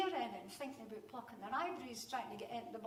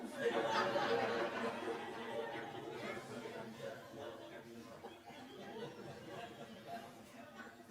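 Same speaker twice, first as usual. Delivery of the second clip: long helpless laugh; 13 semitones lower than usual. Real, not acted. Storyteller remembering the classroom joke, laughing hard. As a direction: laugh until you can't stop.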